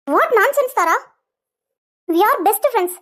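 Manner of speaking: loud, offended rebuttal; indignant denial. Acted. Woman rejecting Tara's love accusation, indignant denial. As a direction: push back forcefully on each phrase. Denies accusation of loving Ravi.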